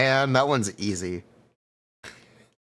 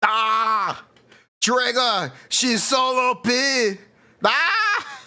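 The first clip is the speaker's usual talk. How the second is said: thrilled shouts over a game win; excited yelling; 12 semitones higher, much slower than usual. Real, not acted. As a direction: yelp with delight.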